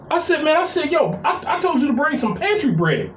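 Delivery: high, fed-up scolding; exasperated scolding. Retold or imitated. Brother scolding lazy sibling, high exasperated scold. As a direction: chew him out in a high pitch.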